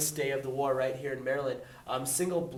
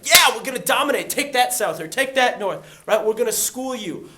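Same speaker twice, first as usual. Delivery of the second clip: loud, much higher brag; cocky boast; 8 semitones higher, 10 dB louder than usual. Retold or imitated. Both sides boasting of a quick victory, loud cocky brag. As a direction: crow like a sure winner. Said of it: "Both sides were like".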